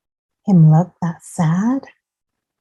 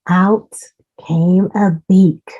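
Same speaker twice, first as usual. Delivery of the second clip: ordinary delivery, nothing marked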